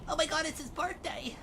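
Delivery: high, pinched fanboy gush; nasally voice. Pretend. Echoing a friend's role-play, nasally voice. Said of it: "I can't do the nasally voice".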